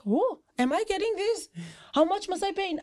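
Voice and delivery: surprised disbelief. Retold or imitated. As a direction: ask as if it's too good to be true. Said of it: "I'm like".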